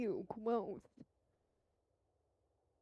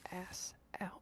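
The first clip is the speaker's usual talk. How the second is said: whispering; 10 dB quieter than usual. Real, not acted. Whispered threat.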